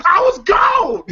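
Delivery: high, loud, crying with laughter; punchline shout. Retold or imitated. Uncle bragging to Craig about moving out, high laughing shout.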